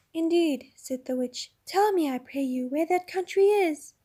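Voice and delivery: high, slow and silky; the witch humouring Puddleglum; mock-polite witch. A narrator's reading. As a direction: tease him with a sweet, drawn-out question. Witch asks slyly.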